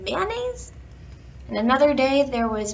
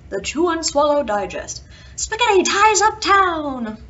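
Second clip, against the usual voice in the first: headline announcer voice; 4 semitones higher, 7 dB louder than usual. Acted. Reading out a newspaper headline.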